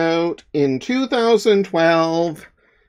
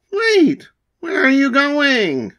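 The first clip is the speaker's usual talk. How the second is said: high surprised call after a runaway pet; startled call; 8 semitones higher than usual. Acted. Monster runs away.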